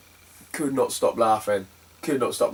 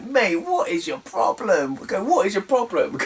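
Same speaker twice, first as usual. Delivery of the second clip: high, drawn-out question; retold exasperated challenge; 6 semitones higher than usual. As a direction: confront them, stretching the words. Confronting seller in retold quarrel.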